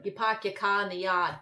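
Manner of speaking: hammed-up Boston accent; mock Boston townie accent; horrible Boston accent. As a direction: drop the r's like a bad Bostonian. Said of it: "It's a horrible Boston accent".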